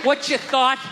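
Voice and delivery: loud, high, heated rant; high, loud rant at a heckler; heated shout. Real, not acted. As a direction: yell it at the crowd. Comic yelling at a heckler.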